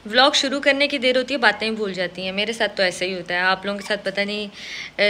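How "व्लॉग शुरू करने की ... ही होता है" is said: ordinary talk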